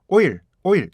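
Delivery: 오일 as two Korean syllables, middle sound missing; Korean-style oil. Shown as a demonstration. Teacher imitates Korean learners, Korean pronunciation. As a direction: say "오일" as plain oh-il. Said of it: "중간에 소리를 잘 못 내요".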